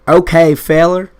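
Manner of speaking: mock country challenge, loud and rising; drawled okay feller. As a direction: throw out a loud, cocky okay feller with a rise. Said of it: "Actually, he's more like".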